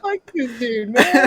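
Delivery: high-pitched imitation of angry crying; mock crying. Worker crying mad in the freezer, mock tearful whine. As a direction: whine it high, pretending to cry. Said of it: "I was crying".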